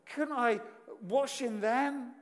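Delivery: loud, wounded pride boiling over; enraged Naaman. Retold or imitated. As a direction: huff like a slighted general. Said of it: "He's in a rage".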